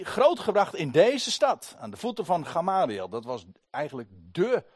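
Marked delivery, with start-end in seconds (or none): 0.0-3.1 hoog en luid, langzaam; plechtige toespraak. Acted. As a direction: spreek het gewichtig en trots uit. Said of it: "zegt hij". (Paulus vertelt trots over zijn opleiding)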